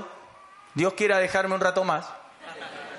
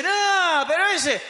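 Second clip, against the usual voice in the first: devil's voice; 9 semitones higher, 3 dB louder, slower than usual. Acted. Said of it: "viene el diablo y dice".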